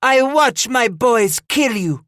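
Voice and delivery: high, loud, slow taunt; yelling. A narrator's reading. The matriarch threatens her besiegers from the stockade, yelling. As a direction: yell it with drawn-out menace. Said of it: "she yelled in reply".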